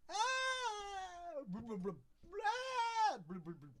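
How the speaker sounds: bubbly goldfish babble; bubbly forgetful goldfish voice. Pretend. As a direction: babble like a dim fish. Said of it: "I like to go".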